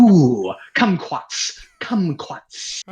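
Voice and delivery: mock warm-up with exaggerated diction; vocal warm-up demo. Shown as a demonstration. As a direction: run a playful actor's vocal exercise. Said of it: "warm-up things that they do".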